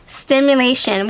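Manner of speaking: normal talking voice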